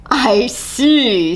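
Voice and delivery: slow, amused lion drawl; a lazy king unimpressed; lightly voiced lion; dry lion.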